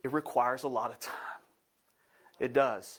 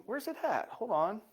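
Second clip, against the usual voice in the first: puzzled; 7 semitones higher than usual. Real, not acted. Searching phone, high pitch.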